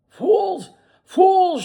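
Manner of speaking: high, loud scornful yell; angry shout. A narrator's reading. Benjamin shouting at the naive animals, angry. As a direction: bark it at them in scorn. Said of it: "shouted Benjamin".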